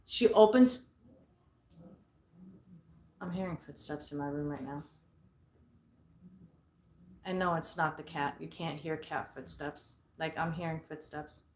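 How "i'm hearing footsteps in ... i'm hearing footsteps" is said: low, soft voice, distracted by noises; hushed aside. Real, not acted. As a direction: break off and remark softly. Breaks off on hearing noises.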